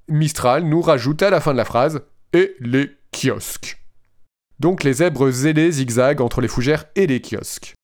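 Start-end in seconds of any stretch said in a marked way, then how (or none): 2.3-3.8 slow, even machine voice; robot voice. Retold or imitated. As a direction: read it like a chatbot. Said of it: "avec ma belle voix de robot".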